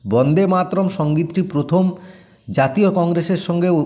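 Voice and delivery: ordinary delivery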